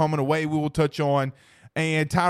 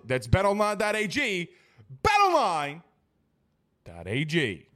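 softer, smooth sponsor read; softer, polished sponsor spot; 5 dB quieter than usual. Acted. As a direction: read the ad copy like a radio spot. Reads sponsor ad.

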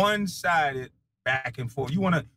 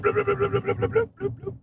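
censor bleep. Pretend. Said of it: "'cause I can't say shit".

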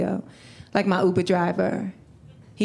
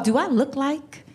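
indignant rejection; 5 semitones higher than usual. Acted. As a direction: reject him with rising indignant questions. Acted indignant rejection of a suitor.

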